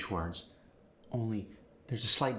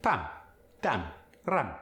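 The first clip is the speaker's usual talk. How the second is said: Spanish accent. Shown as a demonstration. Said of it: "get the ah sound in there".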